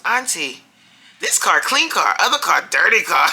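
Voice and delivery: put-on a four-year-old making her verdict on the cars; little girl's voice. Little Kimani telling her auntie which car is better, high excited child voice. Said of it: "So Kimani goes".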